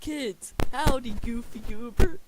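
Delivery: high, loud line dissolving into laughter; cracking up. Real, not acted. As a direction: fight real giggles through the greeting. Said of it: "why I'm laughing".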